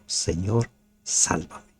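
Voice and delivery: soft, breathy cry for help; hushed desperate plea. Shown as a demonstration. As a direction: plead for rescue under your breath. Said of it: "Grita como Pedro". (súplica susurrada y desesperada)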